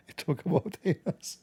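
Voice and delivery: soft words broken up by laughter; derisive laughing. Retold or imitated. Host mimicking offended critics' complaint, laughing. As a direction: giggle helplessly while parroting the complaint.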